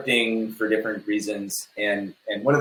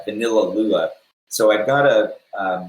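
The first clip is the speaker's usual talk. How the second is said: normal talking voice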